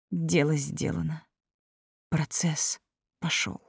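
soft, slow and hushed; quiet. A narrator's reading. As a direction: say it barely above a breath. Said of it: "тихо произнесла Элина".

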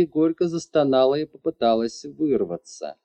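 usual speaking voice

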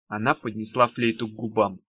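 ordinary delivery

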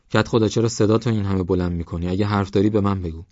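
ordinary delivery

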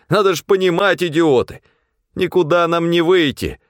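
high-pitched, exasperated shouting; desperate outburst. A narrator's reading. Supply man telling companions they are doomed, desperate outburst. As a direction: berate them in despair.

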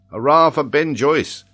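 loud, high hurrah from a band of men; rowdy convicts' cheer; cheering cry. A narrator's reading. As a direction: raise a rough cheer for your leader. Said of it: "cried the convicts".

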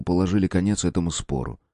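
usual speaking voice

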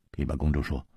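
plain reading voice

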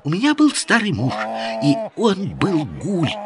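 put-on high, rapid girlish chatter. A narrator's reading. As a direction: rattle it off like an excited girl. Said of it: "быстро затараторила Джамиля".

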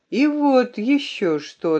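slow, tentative opening; hesitant. A narrator's reading. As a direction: add it with some hesitation. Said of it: "добавила я с некоторым колебанием".

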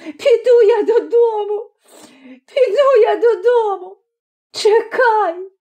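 high-pitched crying wail; sobbing, childlike complaint. A narrator's reading. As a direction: threaten tearfully in a high wail. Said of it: "заголосила".

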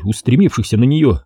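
usual speaking voice

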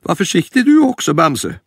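fast, high, anxious granny warning; worried grandma. Acted. Grandma telling Bamse to be careful, quick high voice. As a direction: hurry out a caring warning, pitched high.

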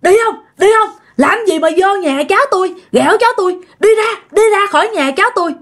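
high-pitched, forceful reenacted scolding; scolding exorcism. Aunt swinging a broom at the ghost, high scolding. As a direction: act out an aunt driving a spirit away. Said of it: "mới quơ tùm lum nói".

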